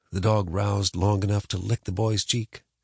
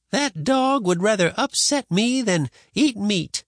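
higher, slow complaining granny; cranky grandma; 13 semitones higher, 3 dB louder, slower than usual. A narrator's reading.

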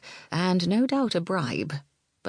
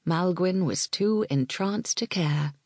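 ordinary delivery, nothing marked

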